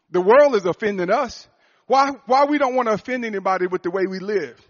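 preacher rousing the church about offending; fiery preaching. Acted. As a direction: drive it home to the congregation. Preaching at full force.

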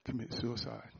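the enemy tempting him while he drives; enemy whispering. Retold or imitated. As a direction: hiss it like a tempter in the ear. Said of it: "the enemy whispers in my ear".

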